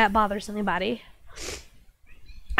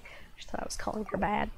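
soft unvoiced whisper; 3 semitones lower, 13 dB quieter than usual. Real, not acted. Reassuring her cat, then whispering an aside. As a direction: whisper it so the cat won't hear.

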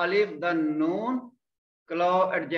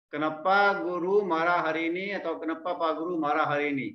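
ordinary talk

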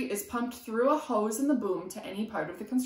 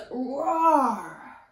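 louder roar for the saw; roaring sound; 4 semitones higher, 8 dB louder than usual. A narrator's reading. Sound word in book.

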